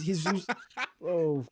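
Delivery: ordinary delivery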